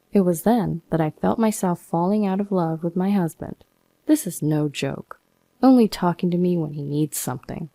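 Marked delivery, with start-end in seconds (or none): none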